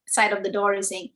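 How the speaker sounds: plain reading voice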